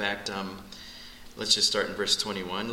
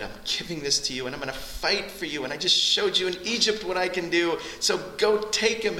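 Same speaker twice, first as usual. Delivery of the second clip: God's challenge; 7 semitones higher than usual. Retold or imitated. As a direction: rise to a ringing push. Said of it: "Because God said".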